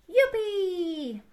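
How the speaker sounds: high, drawn-out happy whoop; little sheep cheering with glee; joyful cry. A narrator's reading. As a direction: whoop it high, stretching the end. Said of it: "Gritó Estofado con alegría".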